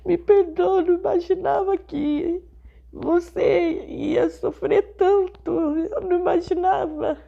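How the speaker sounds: tearful, rising voice begging forgiveness; mother pleading through tears, voice breaking; crying plea. Retold or imitated. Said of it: "E ela chorando, chorando". (súplica chorosa, voz embargada)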